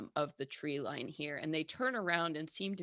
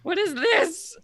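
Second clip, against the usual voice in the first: mocking self; 7 semitones higher, 14 dB louder than usual. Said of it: "I'm just like".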